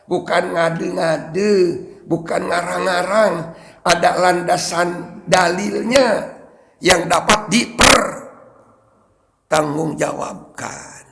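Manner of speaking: usual speaking voice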